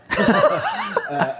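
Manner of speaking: sudden high-pitched laugh; high burst of laughter. Real, not acted. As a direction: break into a loud laugh. Laughs about stewards' long week.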